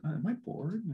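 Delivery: soft, puzzled self-question; musing to himself. Retold or imitated. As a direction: wonder it aloud under your breath. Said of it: "I was like".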